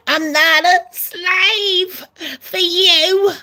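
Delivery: very high, put-on defiant voice, slowing to the end. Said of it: "And [name]'s like".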